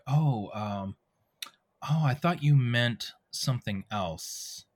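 slow, thoughtful realisation; introspective. Shown as a demonstration. Actor reading a game line with time to spare, introspective. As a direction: say it slowly, pausing as the thought lands. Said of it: "I might be more introspective".

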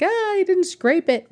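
mock a picky builder's complaint; mock contractor scolding. Mocking picky construction people scolding about scraping. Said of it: "construction-y kind of people be like".

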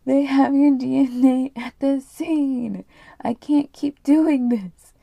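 slow, loud, high-pitched despair; acted anguished outburst. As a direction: belt it out slowly, melodramatic and overwrought. Wife's anguished outburst, redone after laughing.

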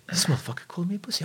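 high, fast whispered grumble; fired-up whisper. Retold or imitated. Client insulted by the trainer, whispering. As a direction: hiss it like a stung ego plotting revenge. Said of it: "And you were like".

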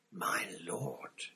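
soft, breath-only whisper to his master; awed whisper. A narrator's reading. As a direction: whisper it in awe. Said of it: "he whispered".

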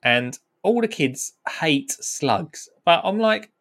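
normal talking voice

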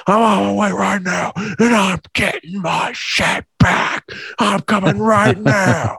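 high, strained mock yelling; hammed-up furious caller; imitated ranting caller. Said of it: "This guy just going".

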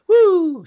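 sudden high, loud woo; excited whoop. Real, not acted. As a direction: whoop like a rocket launching. Hyping the host's ratings up.

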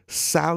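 SAL- pitched high, tongue flapping on an endless L; exaggerated trilling L. What he hears in salmon, SAL- blown up into endless L's. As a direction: hold SAL- into an absurd long "lulululu". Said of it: "I hear".

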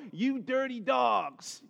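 slow, drawn-out telling-off; mock scolding. Imagined scolder with someone over his knee, mock scolding.

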